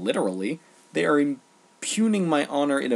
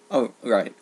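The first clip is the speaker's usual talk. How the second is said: normal talking voice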